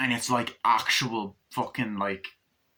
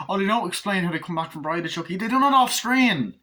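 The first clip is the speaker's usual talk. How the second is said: high, whiny mock complaint; mocking whiner; 8 semitones higher, 4 dB louder than usual. Mocking fans who moaned, high and whiny. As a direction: mimic fans moaning. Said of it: "some people actually moaned".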